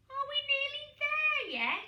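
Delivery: high, loud whining chorus; bored little penguins in the back seat; impatient chicks. A narrator's reading. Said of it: "said Peaky, Poots and Pog".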